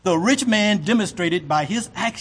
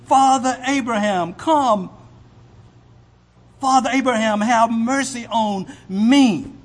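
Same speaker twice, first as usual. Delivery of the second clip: raised, higher pleading voice; rich man begging; 5 semitones higher, 4 dB louder than usual. Acted. Rich man begging Abraham for mercy, raised plea. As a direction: beg for mercy from below. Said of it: "Now he's begging".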